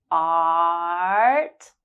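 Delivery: long drawn-out ah in "Art"; held ah. Shown as a demonstration. Teacher drawing out the ah in "Art" for learners. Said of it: "has the ah sound right at the beginning".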